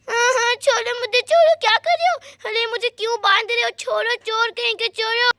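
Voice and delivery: put-on child's voice begging to be released; protesting little girl. Sonia to the thief tying her up, alarmed protest.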